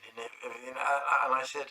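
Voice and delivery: ordinary delivery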